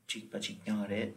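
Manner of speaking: ordinary delivery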